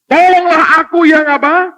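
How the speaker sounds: high loud plea for help; pleading cry. Acted. Father begging Jesus for help, high loud plea. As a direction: beg loudly, voice up high. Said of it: "langsung disambung dengan perkataan".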